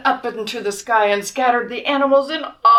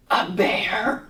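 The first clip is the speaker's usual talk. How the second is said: suspense whisper; 2 semitones lower, 4 dB quieter than usual. Acted.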